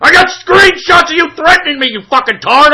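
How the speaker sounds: real indignant yelling; angry accusation. Real, not acted. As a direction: hammer the accusation at high pitch. Angry quarrel, repeats accusation.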